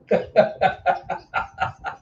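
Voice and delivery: long wordless chuckle; cackling laugh. Acted. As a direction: burst into rattling laughter. Laugh after the monkey mother question.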